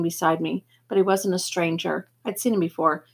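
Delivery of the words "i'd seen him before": usual speaking voice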